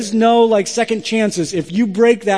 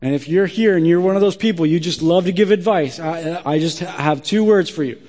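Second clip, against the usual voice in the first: ordinary talk, nothing marked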